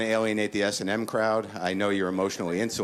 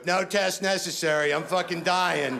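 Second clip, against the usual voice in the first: much higher, slow, hammed-up doom; melodramatic patient; 10 semitones higher than usual. Patient telling the doctor he is dying, hammed-up doom. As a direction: announce your death to the doctor. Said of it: "just walk in and go".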